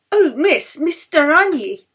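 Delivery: loud, high call across the ward. Acted. Ward matron calling out to the violinist, loud. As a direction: stop her from across the room.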